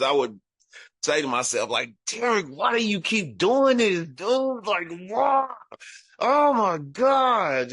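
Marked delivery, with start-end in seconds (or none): 2.1-7.7 high-pitched, pleading exasperation; retold cry of despair to oneself; anguished self-questioning. Retold despair after beatings.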